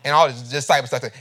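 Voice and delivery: usual speaking voice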